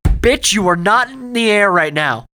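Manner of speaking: loud high mock outrage; loud scolding shout; laughing yell. Real, not acted. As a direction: bellow it at him, half laughing. Cohost yells at pretend hovering.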